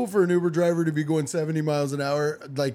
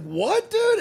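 very high startled squeak; mock surprise. Retold own startled reply.

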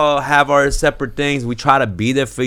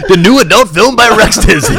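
movie trailer voice; 8 semitones higher, 8 dB louder than usual. Pretend. Mock film title, laughing.

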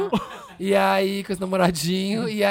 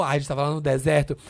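normal talking voice

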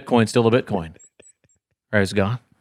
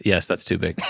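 ordinary delivery

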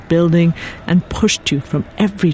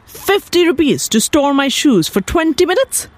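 much higher, incredulous question; outraged disbelief; 10 semitones higher than usual. Acted. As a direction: balk at the price, voice leaping up. Husband outraged at shoe fee.

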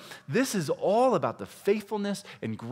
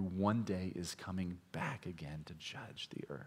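hushed whisper; 8 semitones lower, 14 dB quieter than usual. Real, not acted. Preacher whispering to the congregation about Christ's return, hushed. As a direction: whisper it, solemn and low. Sermon reaching its solemn climax.

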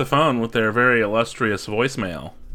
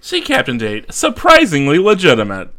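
mock headline; 7 semitones higher, 7 dB louder than usual.

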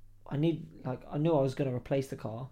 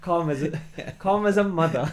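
laughing; 4 semitones higher, 7 dB louder than usual. Real, not acted. Laughing at the joke.

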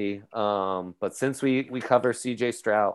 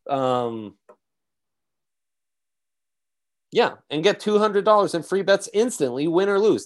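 excited sponsor read, pushing the offer; hyped ad read; 2 semitones higher than usual. Acted. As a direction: sell the deal with big energy.

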